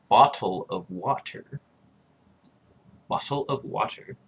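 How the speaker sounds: "bottle of water" with every T sounded; careful crisp T's in "bottle of water"; T's pronounced. Shown as a demonstration. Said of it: "Pronunciating the T's".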